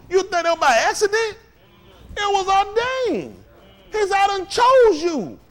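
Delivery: impassioned pulpit cry that climbs in volume; full-force preaching. Real, not acted. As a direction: preach it hard, stretching the final words.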